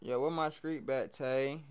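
ordinary delivery

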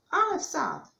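high, loud exclamation; excited cheer. A narrator's reading. Narrator marvels at the rabbit's dunk, cheering.

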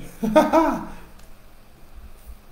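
a high breathy laugh bursting out; burst of laughter. Real, not acted. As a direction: laugh out loud at the joke. Laughs mid-sermon at the congregation.

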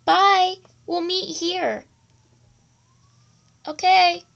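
loud goodbye across the distance; calling out. Acted. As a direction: shout the farewell to a friend walking away. Kids calling out while parting.

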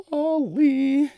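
high, loud cry of walleye; excited yell. Real, not acted. Angler shouting over his catch, thrilled yell. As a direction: shout the fish's name in delight.

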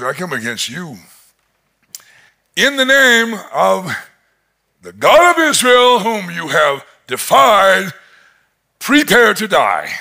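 building to a loud, high declaration; bold proclamation. Retold or imitated. David defies Goliath in God's name, loud proclamation. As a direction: proclaim it with rising defiance. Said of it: "he goes".

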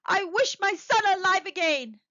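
high, loud solemn invocation; declaimed wish. A narrator's reading. Old man wishing on the talisman, loudly declaimed.

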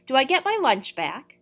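petulant question. A narrator's reading. Sulky boy pleading for lunch.

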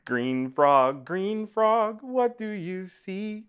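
chanted refrain, leaping high and loud on the repeat; sing-song story reading. Acted. Reader to children with picture book, sing-song chant. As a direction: lilt it musically for little listeners.

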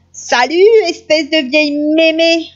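put-on high, brash girl's yell; shouting. A narrator's reading. Chaperon noir insulting grandmother on entering, brash shout. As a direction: burst in yelling, pitched well up. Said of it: "entra et cria".

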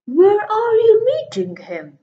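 high, loud anxious fox; suddenly worried, voice shooting up; nervous fox. Acted. Fox alarmed at the monster, high and loud.

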